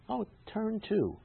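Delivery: slow, high-pitched "oh" of discovery; drawn-out feigned dawning recognition; mock realization. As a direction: play at suddenly noticing the words. Feigns sudden recognition while reading.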